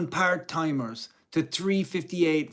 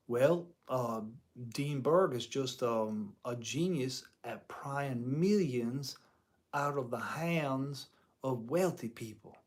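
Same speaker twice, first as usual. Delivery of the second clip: lazy Southern drawl, low and quiet; imitating a Southern drawl; slow Southern drawl, soft; 4 semitones lower, 8 dB quieter than usual. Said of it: "southern drawl".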